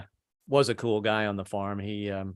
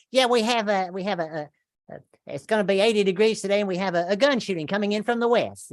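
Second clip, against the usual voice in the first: higher, bright forecast-style read; mock weatherman; 8 semitones higher than usual. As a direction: read it like a cheery TV weather report.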